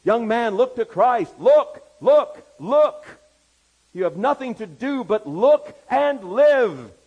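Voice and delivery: high, full-throated revivalist shout; preacher's shout. A narrator's reading. Primitive Methodist preacher urging a young man, shouting. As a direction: cry it out, punching each single word. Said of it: "he shouted, as only a primitive Methodist could do".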